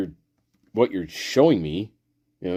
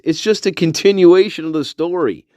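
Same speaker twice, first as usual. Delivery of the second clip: higher, projected character voice; comic plug; 7 semitones higher than usual. Pretend. Pointing viewers to earlier shorts, higher projected voice. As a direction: announce it brightly to the audience. Promotes shorts, then repeats softer.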